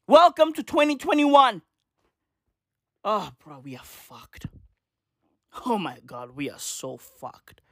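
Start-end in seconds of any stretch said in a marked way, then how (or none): 3.0-7.6 low, very soft groan of doom; hushed, hopeless sign-off; resigned despair. Real, not acted. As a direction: let it sink to a quiet, defeated low. Despairing sign-off about the state of things.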